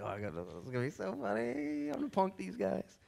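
aside that climbs high with glee; acted gleeful scheming. Jesus hiding his name tag to punk disciples, giddy scheming. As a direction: play a prankster barely containing himself.

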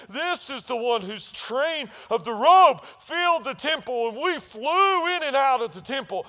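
loud, high sermon climax; preaching at full force. Real, not acted. Pastor proclaiming God's command to the church, full-force preaching. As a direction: drive it home to the congregation.

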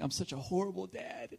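lightly imitated tearful self-blame; mock crying. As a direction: whimper it like you're about to cry. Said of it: "I start crying".

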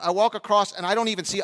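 normal talking voice

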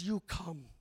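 quiet voice of the Lord. Acted. Lord answers Peter.

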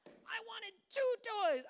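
high, loud, drawn-out whine; demanding kid. Acted. Kid complaining to parent about toys, loud mock whine. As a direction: whine like an ungrateful child. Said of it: "And then they're like".

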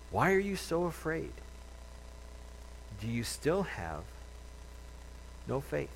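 soft, measured rebuke with long pauses; gentle questioning. Acted. Jesus to his disciples after the storm, softly questioning. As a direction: ask it quietly, letting each phrase hang.

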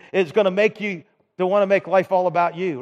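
normal talking voice

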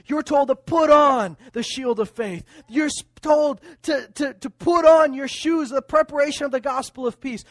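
loud, rising fiery preaching; preaching at full force. Acted. Preacher challenging believers about daily choices, fiery and loud. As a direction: drive it home to the pews.

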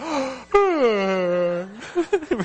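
big, high, drawn-out mock yawn; imitated yawn. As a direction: imitate a crowd yawning back. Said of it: "Sorry, I yawned there".

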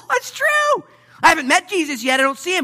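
high, loud dawning excitement; excited realization. Acted. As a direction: voice the disciples catching on, high and thrilled. Disciples realize, voiced by preacher.

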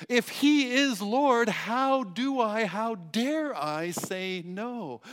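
slow, loud, weighty preaching; preaching with full force, slow; emphatic. Real, not acted. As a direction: drive each word home slowly. Preacher pressing the point.

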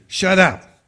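put-on a sulky teen snapping back; snapping teenager. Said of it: "A teenager".

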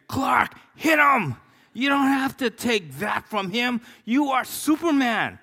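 high, loud urging shout at the screen; inner voice shouting. Retold or imitated. Pastor's inner voice yelling at Superman, high and loud. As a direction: yell at Clark to fight back. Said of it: "everything inside of me is saying".

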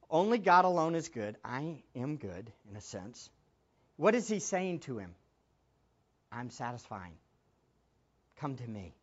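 soft call to come; intimate hushed invitation. Acted. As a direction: draw him in gently. Jesus voiced inviting the ruler.

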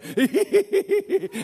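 high-pitched mock gleeful giggle; gleeful cackle. Shown as a demonstration. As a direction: cackle like a greedy villain, hammed up. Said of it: "kinda gleefully say".

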